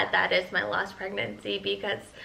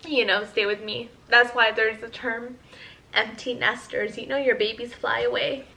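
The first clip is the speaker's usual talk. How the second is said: ordinary talk, nothing marked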